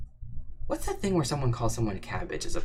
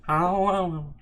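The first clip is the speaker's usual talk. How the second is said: higher, breathy pop-star imitation; 6 semitones higher than usual. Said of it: "[name]'s [name] impression".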